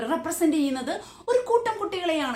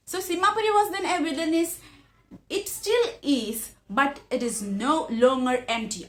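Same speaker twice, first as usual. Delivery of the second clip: slow, careful read-aloud of the English passage; measured classroom reading; slow textbook reading; much slower than usual. Acted. Reads text aloud.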